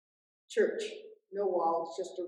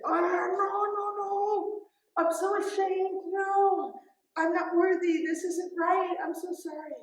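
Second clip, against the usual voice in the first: much higher, embarrassed refusal of praise; flustered protest; 10 semitones higher, 4 dB louder than usual. Retold or imitated. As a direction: wave off the honour in a flustered rush. Protests being called Saint Francis.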